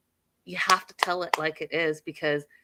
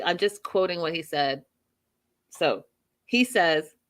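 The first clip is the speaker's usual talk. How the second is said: ordinary talk, nothing marked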